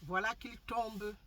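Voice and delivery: usual speaking voice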